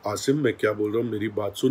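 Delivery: soft, low, quick reasoning; calm quiet voice. Retold or imitated. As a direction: calmly try to reason with him. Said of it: "जिस लहजे में, जिस level में, जिस tone में बात हुई". (धीमे शांत लहजे में समझाना)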